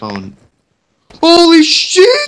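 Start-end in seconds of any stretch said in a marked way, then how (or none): 1.2-2.3 shocked, loud shout; startled yell. Real, not acted. Sudden enemy surprise.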